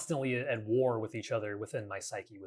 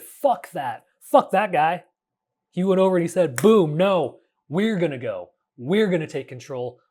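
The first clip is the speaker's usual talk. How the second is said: louder, higher angry outburst; angry takeover; 5 semitones higher, 9 dB louder than usual. Retold or imitated. The angry inner self seizing control, loud furious outburst. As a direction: bark it out like the angry inner self taking charge. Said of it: "who said".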